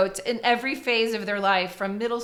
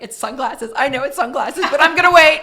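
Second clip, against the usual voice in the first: building up to a loud, higher wait; mock suspense; 5 semitones higher, 11 dB louder than usual. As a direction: tease it like a held-back reveal. Host's inner voice, building.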